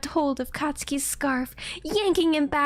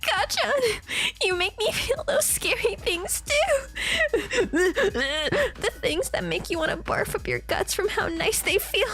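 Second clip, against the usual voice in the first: laughing through tears; 9 semitones higher than usual. Acted. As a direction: laugh and cry the words out. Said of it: "Izuku spoke softly".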